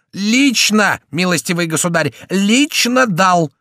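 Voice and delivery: high, loud and indignant; exasperated, high-pitched insistence; emphatic insistence. Acted. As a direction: hammer it home, stretching the key word. Chicot insists against doubting officer.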